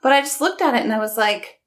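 ordinary talk

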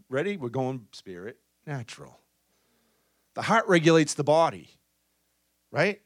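ordinary talk, nothing marked

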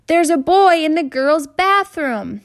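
high, loud, slow scream after someone. Acted. Girl screams after the fleeing boy, high loud scream. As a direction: shriek it down the hall for all to hear. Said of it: "the girl screamed after him".

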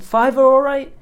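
"Fiver all right?" asked up high and unsure. Retold or imitated. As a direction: sheepishly ask for the fiver, pitched high.

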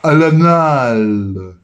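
Al-Anal stretched out very slowly, each syllable long; very slow drawl. Pretend. Teasing his cohost, he stretches Al-Anal very slowly. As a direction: drag out Al-Anal as slowly as you can. Drawn-out echo of the word.

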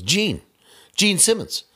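high calling shout, repeated. Retold or imitated. Shatner calling from car.

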